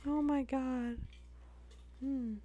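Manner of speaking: high voice after a laughing fit; high, helpless amusement; breaking up laughing. Real, not acted. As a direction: squeeze words out through laughter.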